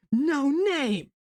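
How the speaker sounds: high-pitched mock scream; retold scream. Hollow Ichigo declaring himself to Byakuya, acted high scream. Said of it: "And he screams".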